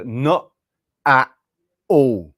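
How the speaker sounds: "not at all" in separate words, each t stopped; stopped-air "not at all". Shown as a demonstration. Coaching an American friend to stop the air, "not at all" with stopped t. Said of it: "you have to stop the air".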